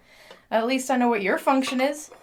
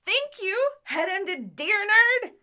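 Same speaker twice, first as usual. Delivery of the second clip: teasing mockery; 8 semitones higher, slower than usual. Teasing friend's earlier title.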